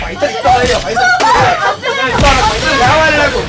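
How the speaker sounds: loud, high, yelled demands; angry shouting. Acted. Drunken father screams for money at his family. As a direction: shout it over and over.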